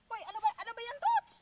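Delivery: sudden loud high yelp; startled shout. Real, not acted. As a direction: cry out in alarm. Startled by the horse moving.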